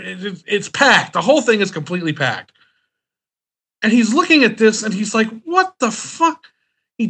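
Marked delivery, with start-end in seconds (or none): 5.4-6.5 high, slow, stunned disbelief. Retold or imitated. Assistant staring at the packed trailer, stunned. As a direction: gape at the pile of bags.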